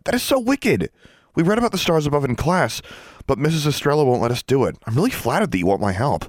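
bubbling over with delight; excited Sonia. Acted. Sonia gushes to Alexandra about the ritual, high and thrilled.

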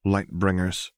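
ordinary delivery

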